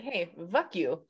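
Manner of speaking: slow laughing Hey, vuck you with V; mimicking V for F. Mocking friend's V-for-F accent, slowly laughing. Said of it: "her V's like F's".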